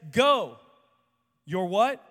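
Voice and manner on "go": commanding go. Acted. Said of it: "And Jesus says".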